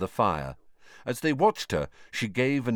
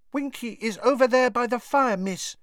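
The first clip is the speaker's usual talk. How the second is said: lightly voiced little elf, high and soft; a touch of a sad squeaky elf; quiet house elf. A narrator's reading. Dobby tells Hermione about Winky, quiet and downcast. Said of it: "said Dobby quietly".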